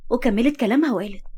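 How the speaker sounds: plain reading voice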